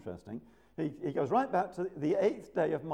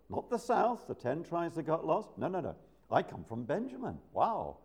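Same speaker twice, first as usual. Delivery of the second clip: ordinary talk, nothing marked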